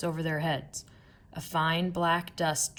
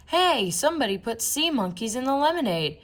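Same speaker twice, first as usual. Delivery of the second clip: higher, a bit louder call; mild shout; 6 semitones higher, 4 dB louder than usual. A narrator's reading. People at the game calling out, mild higher shout. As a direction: call out the prank to the crowd. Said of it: "people shouting".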